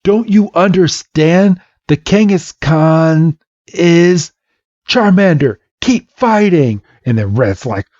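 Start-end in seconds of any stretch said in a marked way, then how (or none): 0.0-4.3 high, stretched-out pleading; acted desperate insistence; urgent plea. Red pleads in acted quarrel. 4.9-6.8 high, forceful battle order; acted determined call; insistent command. Blue overrides with a command.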